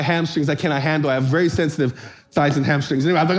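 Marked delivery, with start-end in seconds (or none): none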